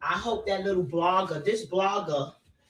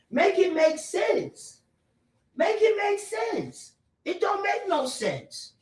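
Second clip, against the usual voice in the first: exasperated plea; 9 semitones higher, slower than usual. Real, not acted. Repeated exasperated demand.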